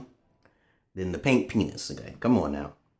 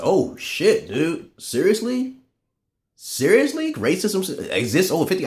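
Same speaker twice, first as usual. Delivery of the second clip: high-pitched retold disbelief, rising on seriously; mock-shocked reply to his friends; 10 semitones higher than usual. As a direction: act floored by the number. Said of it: "I said".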